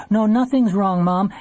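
a quick, mild cover-up to Mom; hasty. A narrator's reading. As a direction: add it quickly into the phone. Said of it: "she added quickly".

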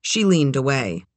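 plain reading voice

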